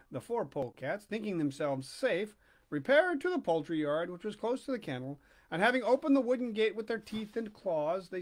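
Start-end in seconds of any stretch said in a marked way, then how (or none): none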